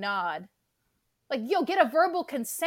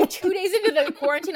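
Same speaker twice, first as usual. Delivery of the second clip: laughing; 7 semitones higher, 6 dB louder, faster than usual. Real, not acted. Laughs while imagining.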